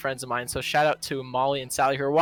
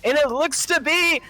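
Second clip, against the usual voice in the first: hammed-up Southern race commentary, drawn-out names; terrible Southern accent; 9 semitones higher, 6 dB louder, slower than usual. Pilot parodying a friend's commentary, exaggerated Southern accent. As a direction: drawl the race call in a mock Southern accent. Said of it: "my terrible impersonation of a Southern accent".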